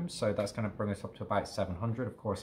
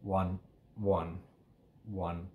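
slow counted tempo; 3 semitones lower, 5 dB quieter, much slower than usual. Shown as a demonstration. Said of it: "one, one kind of pace".

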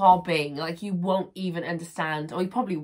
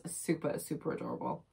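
soft gushing; 6 semitones lower, 11 dB quieter than usual. Real, not acted. Gushing over kitten plate.

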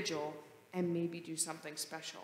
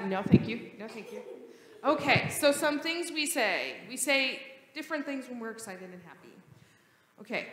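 ordinary delivery

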